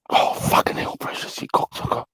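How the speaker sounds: hushed whisper so as not to wake anyone. Real, not acted. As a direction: breathe the words out with no voice. Everyone asleep, speaking under breath.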